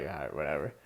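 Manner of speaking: low, soft, offhand brush-off; mock a resigned shrug, voice dropped low; dismissive. Brushing off the cousin in retold exchange.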